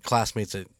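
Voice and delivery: usual speaking voice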